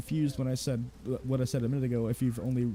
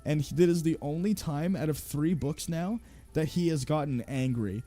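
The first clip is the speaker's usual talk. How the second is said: ordinary delivery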